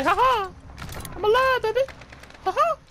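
high, loud victorious cackle and cheer; triumphant laugh. Real, not acted. Gamer cheering to his squad after surviving, high laughing crow.

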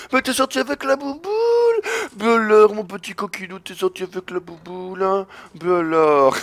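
high, soft teasing scold; tut-tut a naughty kid; mock scolding voice. Gamer teasing the opponent who stepped out, mock scold.

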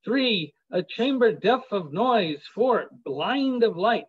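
lilting verse reading; poem recitation. Acted. Reads sonnet list aloud.

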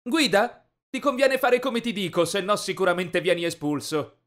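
loud, high-pitched bossy order; bullying command. A narrator's reading. Rich bully forcing the boy to drive, loud threatening command. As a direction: threaten him into obeying.

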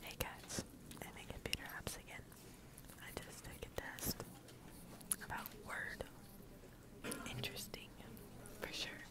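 hushed, secretive whisper; real low whisper in computer class. Real, not acted. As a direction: whisper to the camera so the class won't hear. Secretly vlogging during computer class.

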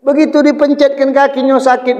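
normal talking voice

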